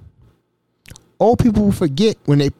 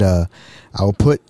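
ordinary talk, nothing marked

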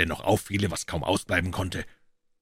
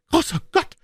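much higher, louder cry of Großer Gott; shocked exclamation; 5 dB louder than usual. A narrator's reading. The client realizes he was fooled, crying out higher and louder. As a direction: burst out in sudden realization. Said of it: "rief unser Klient".

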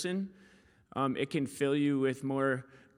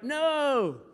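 put-on toddler refusal; 12 semitones higher, 5 dB louder than usual. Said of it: "And she's like".

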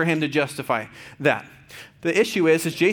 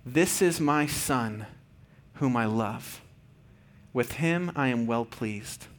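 slow, soft and lower, like a father blessing his son; tender fatherly voice; 4 semitones lower, 5 dB quieter, much slower than usual. Retold or imitated. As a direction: let each word land gently with love. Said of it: "the Father says to the Son".

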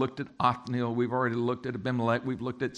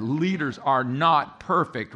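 "Leaders are not perfect" said slowly and firmly, stressing "not"; emphatic restatement; 5 dB louder, slower than usual. Real, not acted. Preacher wanting a better response, emphatic stress on "not". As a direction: drive home "not" in "Leaders are not perfect", slow and deliberate. Repeats point after weak congregational response.

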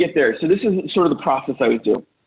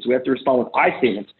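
usual speaking voice

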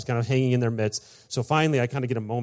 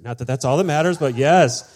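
louder, rising eager aside; super excited; 4 semitones higher, 10 dB louder than usual. Retold or imitated. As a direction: burst out in delighted approval. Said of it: "I'm, like, super excited".

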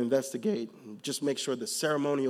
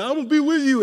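mock promise; 10 semitones higher, 8 dB louder, faster than usual. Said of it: "you like".

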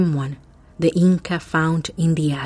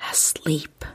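whispering; 6 dB quieter than usual. A narrator's reading. Suspense narration.

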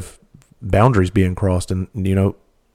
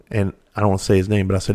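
normal talking voice

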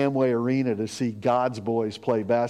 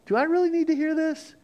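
stopping gossip. Retold or imitated. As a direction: halt the gossiper with raised-pitch disbelief. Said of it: "and I'm like".

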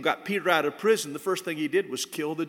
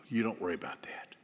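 a quiet, whispered aside to mom; whispered reassurance; 7 semitones lower, 8 dB quieter than usual. Retold or imitated. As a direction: whisper it gently, like a son reassuring. Son reassuring his mother.

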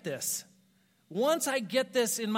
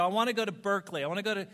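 determined; much faster than usual. Retold or imitated. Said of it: "I go".

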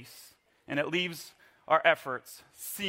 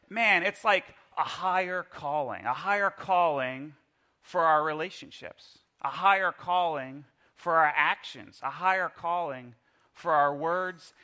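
ordinary talk

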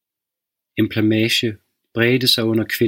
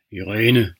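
rough father's call. Acted. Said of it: "Farens stemme var ru".

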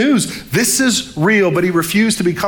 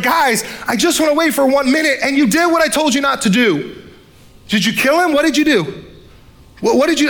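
put-on upset older brother; 8 semitones higher than usual. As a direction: play the panicked big brother shouting at his siblings. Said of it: "He's like".

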